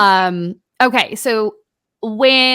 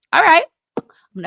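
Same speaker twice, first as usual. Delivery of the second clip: psyching herself up; 8 semitones higher, 3 dB louder, much faster than usual. Retold or imitated. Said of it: "In my brain, I'm like".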